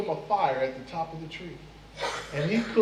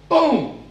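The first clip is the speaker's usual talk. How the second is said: loud, high shouted gunshot noise; gunshot boom; 7 semitones higher, 10 dB louder than usual. Acted. Fly telling his hunting tale, firing his gun: loud boom. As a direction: bark out a gun blast.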